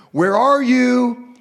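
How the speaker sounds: high, slow, loud sing-song call across the garden; playful calling out. Acted. God calling playfully to Adam and Eve, high loud call. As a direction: call out like a father playing hide and seek.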